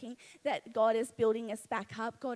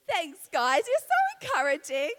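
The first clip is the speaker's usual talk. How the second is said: mock hurt sarcasm; 4 semitones higher, 11 dB louder than usual. Joking after losing her point.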